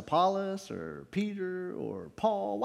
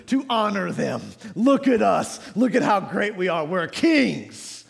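raised, driving pulpit cadence; forceful preaching; 5 semitones higher, 5 dB louder than usual. Real, not acted. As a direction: build the list up like a preacher.

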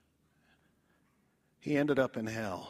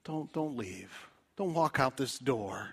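normal talking voice

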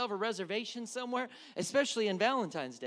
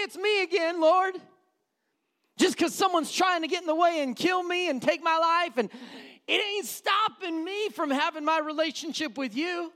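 higher, louder impassioned prayer; fervent prayer shout; 7 semitones higher, 7 dB louder than usual. Acted. Daniel praying defiantly, loud fervent shout. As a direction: call out to God with fire.

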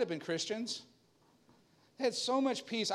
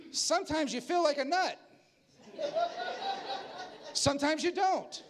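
jingle quote; 8 semitones higher than usual. Retold or imitated. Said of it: "watching Almond Joy commercials".

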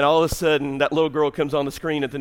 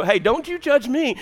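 raised, louder comic protest; mock defensive; 10 semitones higher, 5 dB louder than usual. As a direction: playfully fend off the congregation.

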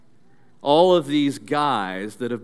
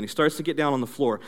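normal talking voice

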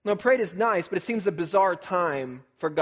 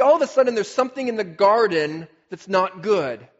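loud, building preacher's cadence; full-force pulpit delivery; preaching; 4 semitones higher, 5 dB louder than usual. Acted. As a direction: proclaim each good thing with rising force. Rising preaching on creation's goodness.

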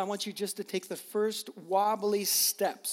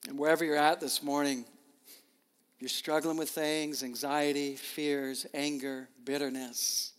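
closing prayer; 3 semitones lower than usual. Real, not acted. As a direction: lead the room gently into prayer.

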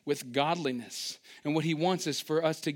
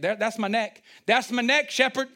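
mock alarm; 6 semitones higher, 4 dB louder than usual. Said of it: "You're like".

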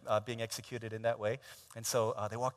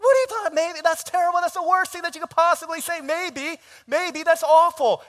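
very high, loud shrill dismay; appalled outrage; 14 dB louder than usual. Retold or imitated. Groom horrified his girlfriend said maybe, shrill and loud. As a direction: wail in horrified disbelief.

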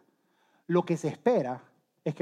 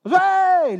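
high, loud call of a name; long, high-pitched holler; sudden shout; 14 semitones higher, 10 dB louder than usual. Acted. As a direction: yell to wake a sleeping friend. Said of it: "le grita".

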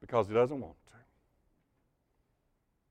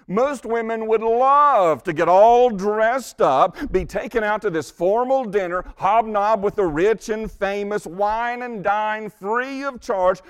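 preaching loud; 6 semitones higher, 5 dB louder than usual. Acted. Preacher builds to a peak.

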